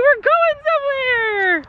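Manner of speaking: high, slow, squealing horse talk; a high, slow, teary squeal of joy; excited horse voice. Pretend. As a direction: voice the horse, giddy to be going out. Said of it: "She's like".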